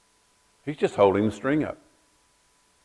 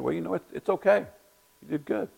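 higher-pitched, softer placating reply; lukewarm reassurance; 8 semitones higher, 5 dB quieter than usual. Retold or imitated. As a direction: imitate a friend hedging his praise. Friend gives lukewarm praise of wall.

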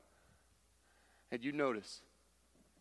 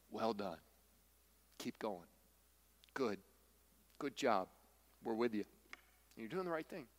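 soft, lower reassurance to tired parents; encouraging words; 6 semitones lower, 6 dB quieter than usual. Real, not acted. Preacher cheering on struggling parents, soft and reassuring. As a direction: pat them on the back gently. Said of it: "I'm here to say".

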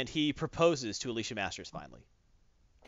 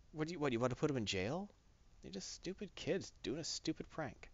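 soft plea; 2 semitones higher, 9 dB quieter than usual. Retold or imitated. Ben defending kids.

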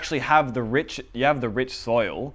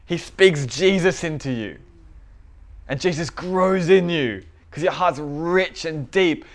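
louder, higher, driving pulpit voice; fervent preaching; 3 semitones higher, 6 dB louder than usual. Acted. Pastor urging the congregation, preaching loud and fervent. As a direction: preach it with rising fire.

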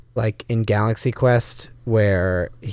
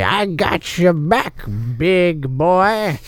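high, slow in-character brag; gruff character voice; 6 semitones higher, slower than usual. Acted. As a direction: play the archer's bravado. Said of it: "I say".

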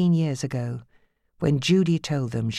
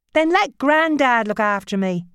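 high, louder bargaining; teen bargaining with mother; pleading teen; 9 semitones higher, 6 dB louder than usual. Acted. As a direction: try another angle. Sammy voiced.

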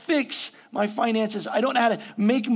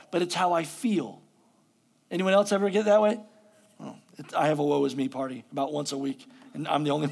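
normal talking voice

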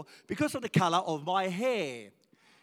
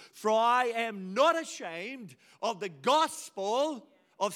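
scripture proclamation; 4 semitones higher than usual. Real, not acted. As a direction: proclaim the verse so it sticks. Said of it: "I want that scripture to be embedded".

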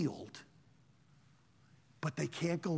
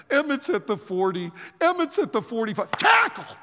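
building play-by-play; 4 dB louder than usual. Retold or imitated. Storyteller reliving a punt return, building excitement.

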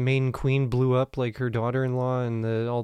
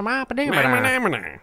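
put-on high old-timey character voice; hammy vintage showman babble; 10 semitones higher, 3 dB louder, slower than usual. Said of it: "old-timey voice".

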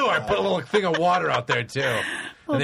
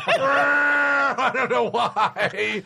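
mock helpless wail; 8 semitones higher, much slower than usual. Acting out helpless cat owner.

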